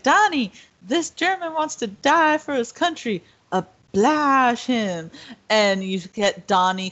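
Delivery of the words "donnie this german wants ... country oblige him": higher, slow mock Brad Pitt order; hammed-up Southern officer giving the order; Lieutenant Rain impression. Retelling the movie scene to a friend, mock Brad Pitt order. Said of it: "Lieutenant Rain's like".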